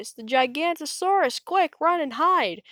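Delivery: high, loud alarm cry. A narrator's reading. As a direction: shout the warning in a panic. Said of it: "Bonehead cried".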